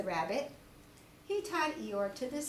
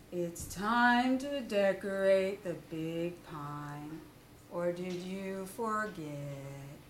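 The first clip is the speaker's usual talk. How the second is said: slow, low, mournful donkey voice; gloomy Eeyore; 5 semitones lower, slower than usual. Acted. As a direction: drag it out like a sad old donkey. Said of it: "said Eeyore".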